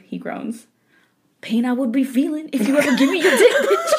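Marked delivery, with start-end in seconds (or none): 1.4-3.9 hammed-up bratty voice, laughing, voice leaping high on bitch; hammed-up bratty girlfriend voice, laughing; picked voice for the wife. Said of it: "This is the voice I've picked".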